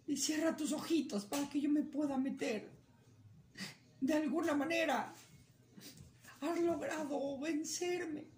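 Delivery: high, sweetly resigned bunny voice; cunning rabbit. Acted. Rabbit tricking coyote into closing his eyes, high coaxing voice. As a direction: fake surrender, coaxing.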